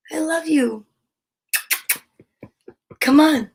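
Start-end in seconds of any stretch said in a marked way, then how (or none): none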